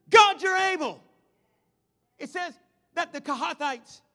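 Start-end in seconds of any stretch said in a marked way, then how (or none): 0.1-1.0 high, booming shout of praise; loud yell. Acted. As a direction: yell it out loudly to God. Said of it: "and yell loudly".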